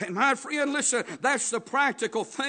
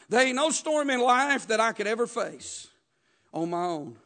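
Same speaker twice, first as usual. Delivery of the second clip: normal talking voice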